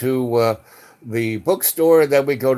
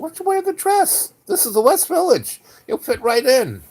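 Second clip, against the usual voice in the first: much higher, teasing sing-song; playful suggestion; 13 semitones higher than usual. Retold or imitated. Narrator urging Yos to wear the dress, high and teasing. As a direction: tease him into the dress.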